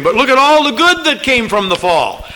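a pushy arguer's retort; arguing objector. Retold or imitated. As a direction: throw the objection back with scorn. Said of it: "someone continued arguing, arguing".

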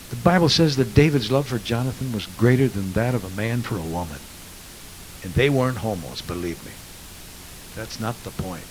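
usual speaking voice

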